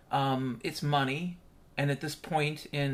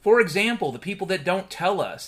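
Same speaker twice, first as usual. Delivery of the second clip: ordinary talk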